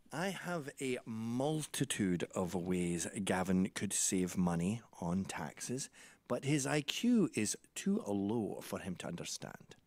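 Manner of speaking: slow, low, deliberate father's voice; imitating dad. Dad explaining his son's low IQ, in slow imitated voice. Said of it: "He said".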